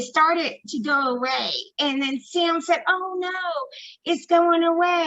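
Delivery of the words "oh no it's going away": high-pitched disappointed plea; imitated sadness. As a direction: mimic a friend's dismay as it leaves. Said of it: "he was all sad".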